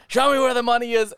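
high, urgent hold-up voice; escalating robber's demand. Acted. Robbers repeating themselves to victims, escalating. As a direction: push the mock demand to its peak. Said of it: "I just kept going".

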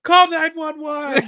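high, slow, loud mock panic; the dying father yelling for help, in mock panic; panicked yell. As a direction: shout it out, hamming up the terror. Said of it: "He's like".

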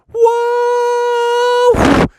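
long high "whoa"; drawn-out cartoon yell; airborne whoop. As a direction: holler as if flying over a jump. Leaping over a jump.

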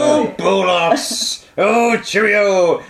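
high, drawn-out prissy British exclamation. Acted. Host mocking bollocks as a wimpy word, prissy British. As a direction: draw it out like a dainty, fussy Brit. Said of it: "sounds like such a pussy word".

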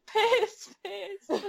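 very high mock calling; high, laughing imitation of a child; imitated little girl. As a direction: pitch it way up like a girl calling her dog. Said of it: "and the girl's like".

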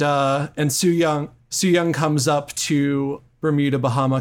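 ordinary talk

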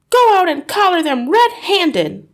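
high, loud, insistent order; urgent hiss. A narrator's reading. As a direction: push a reluctant husband to act. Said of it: "hissed the mother".

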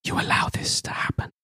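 hushed, fully whispered in-game line; whispered accusation. Acted. Game master voices a dream figure to player, whispered. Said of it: "whispers to you and said".

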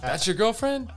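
very high, loud incredulous question; can't believe what he's hearing; disbelief. Retold or imitated. Asking Mo in disbelief, very high and loud.

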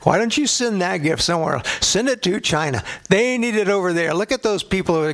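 high, pleading, hammed-up refusal; whiny protest. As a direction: beg off the gift like a panicked kid. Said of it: "We're like".

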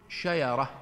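شيرة said sheera, with y in place of the j; dialect pronunciation. Shown as a demonstration. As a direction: say شيرة the way some tribes say it, with no j. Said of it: "وبعضهم يقولون". (لهجة قبلية: شيرة بالياء بدل الجيم)

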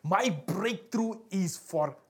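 slow, deliberate declaration; impassioned preaching. Acted. Hannah declaring her breakthrough is sure, preached slowly and emphatically. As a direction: stretch the phrases out with conviction.

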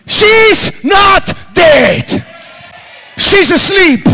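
each word stretched very slowly; slow, stretched preaching proclamation; slow emphatic declaration. Acted. Preacher voicing Jesus' declaration.

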